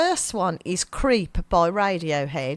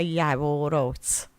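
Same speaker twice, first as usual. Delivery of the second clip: broad Brummie, stretched vowels; Birmingham accent; 5 semitones lower, 3 dB quieter, much slower than usual. Shown as a demonstration. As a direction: demonstrate it slowly in Brummie. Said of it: "practice this".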